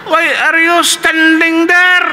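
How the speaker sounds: high, loud, slow professor bark; angry professor. Retold or imitated. Professor catching two students standing, high angry voice. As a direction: scold the standing students like a stern teacher. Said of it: "So galit talaga siya".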